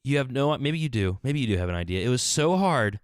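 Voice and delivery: usual speaking voice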